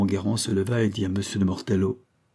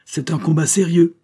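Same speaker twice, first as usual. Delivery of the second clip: raised, high-pitched angry protest; beside himself; 8 semitones higher, 6 dB louder than usual. A narrator's reading. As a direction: shout it as an outraged duellist. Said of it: "hors de lui-même".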